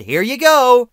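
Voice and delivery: high, loud, drawn-out handover call; mock cheerful shout. Mocking DVD makers slapping stories onto a disc, loud fake cheer. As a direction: sing it out with fake generosity.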